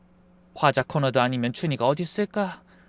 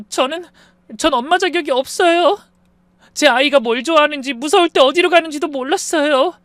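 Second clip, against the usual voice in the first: put-on crying like a child; 11 semitones higher, 5 dB louder than usual. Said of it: "마치 아이처럼 엉엉 울기 시작했습니다".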